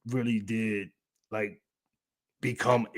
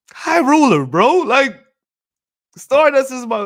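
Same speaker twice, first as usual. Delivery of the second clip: high, loud, emphatic praise; excited shout-out; 12 semitones higher, 11 dB louder than usual. Real, not acted. Streamer shouting out his mentor, loud and high. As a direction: call out his name with gusto.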